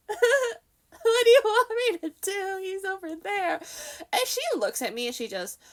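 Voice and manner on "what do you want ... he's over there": loud, high, drawn-out whine; hammed-up high-pitched objection; mock exasperated protest. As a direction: wail it in helpless protest. Acted protest to lady staff.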